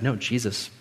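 ordinary delivery

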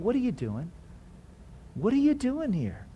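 very soft, loving question; quiet nudge of concern; gentle questioning. Acted. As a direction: ask it in a hushed, tender voice. Spirit lovingly questioning the believer.

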